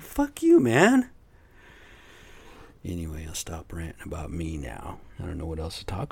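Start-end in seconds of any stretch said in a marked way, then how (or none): 0.0-1.1 retold angry shout, much higher and louder; furious yell. Retelling what he told the doctor, a furious shout. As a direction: blast it back at him like you've had enough.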